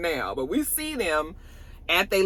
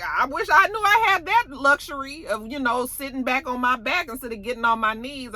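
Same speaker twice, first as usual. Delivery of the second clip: mimicking Roulette; 9 semitones higher than usual. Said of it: "You know, like".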